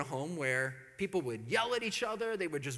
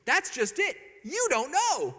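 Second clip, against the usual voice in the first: loud, high, emphatic; gleeful taunt; 9 semitones higher, 7 dB louder, slower than usual. Retold or imitated. Married man to his engaged friend, gleeful taunt. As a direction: crow it at the clueless friend.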